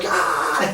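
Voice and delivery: loud, high-pitched mock scream; re-enacted scream. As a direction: cry out as if under the laser. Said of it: "and I'm screaming".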